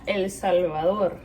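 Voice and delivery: El Salvador in proper Spanish; Spanish accent. Shown as a demonstration. Vlogger showing how to say it properly, Spanish accent. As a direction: say the country's name with native Spanish pronunciation. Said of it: "if you wanna say it properly".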